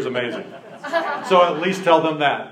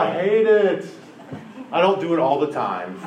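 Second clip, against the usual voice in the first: ordinary talk, nothing marked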